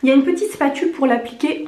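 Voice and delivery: usual speaking voice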